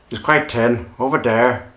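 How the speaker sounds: put-on Irish accent, "tin" for thin and "dere" for there; lightly put-on Irish brogue. Teacher imitating an Irishman's th sounds: Irish accent. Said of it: "the standard Irish accent".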